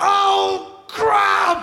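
high, loud drawn-out wail; hammed-up collective dismay; horrified shout. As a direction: cry out as the awful truth dawns. Mimics men's horrified realisation.

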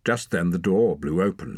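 usual speaking voice

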